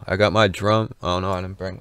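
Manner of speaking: ordinary talk, nothing marked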